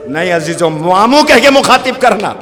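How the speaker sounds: high, pressing mother's instruction; urgent plea. Acted. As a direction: press the point, voice climbing high. Zainab instructs her sons urgently.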